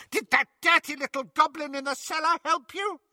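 high screeching witch voice; shrill Bellatrix. Acted. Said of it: "screeched Bellatrix".

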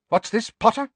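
high, loud, sharp demand. A narrator's reading. Professor rounds on pupil for explanation, sharp. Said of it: "said Professor McGonagall sharply".

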